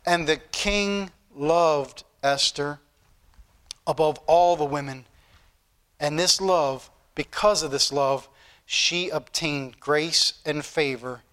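slow, low scripture reading; solemn scripture reading. Acted. Preacher reads Esther's verse to the congregation, slow and solemn. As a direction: read the verse slowly, letting each phrase settle.

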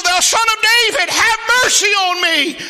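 very high, loud plea for mercy; crying out. Acted. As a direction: shout as the beggar who won't be silenced. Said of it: "he cried the more a great deal".

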